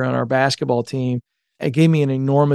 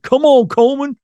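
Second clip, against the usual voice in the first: imitating a coach's Georgia accent, pitched way up and louder; 11 semitones higher, 4 dB louder than usual. Coach greeting the former player on the phone, in a Georgia accent. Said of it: "he's got this deep Georgia accent".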